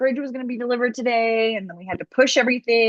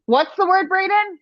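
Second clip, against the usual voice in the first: calling out; 11 semitones higher than usual. Real, not acted. Calling out to husband.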